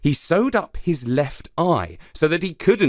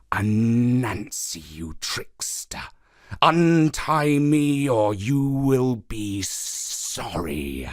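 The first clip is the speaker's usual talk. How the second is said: slow serpent threat, half whispered; trapped python; slower than usual. Acted. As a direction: drag it out like a tied-up snake. Python Anini voiced.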